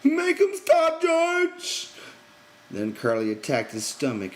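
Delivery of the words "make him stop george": high, loud frightened plea; terrified cry. A narrator's reading. Lenny begs George to stop the beating, terrified. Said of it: "He cried".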